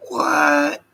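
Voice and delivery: sudden high loud what; startled by a chat message; surprised what. Real, not acted. Streamer startled by a viewer's comment, loud high what.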